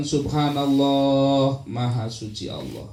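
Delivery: drawn-out, devoted Subhanallah; slow heartfelt dhikr. Shown as a demonstration. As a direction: savour each word of praise slowly. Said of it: "Begitu kita mengucapkan".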